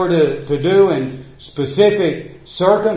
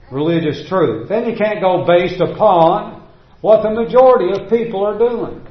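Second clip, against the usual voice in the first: usual speaking voice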